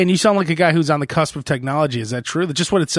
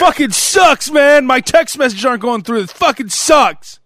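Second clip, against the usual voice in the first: much higher and louder, slow drawn-out curses; angry caller shouting; 8 semitones higher, 5 dB louder, slower than usual. Pretend. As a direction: rant down the line like a fed-up customer. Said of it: "That's, like, their normal call".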